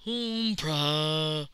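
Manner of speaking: long, low animal trumpeting; drawn-out low hum opening into a blare; forest animal call. A narrator's reading. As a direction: sound a big creature's call through the forest. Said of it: "Who's that shrieking through the forest?".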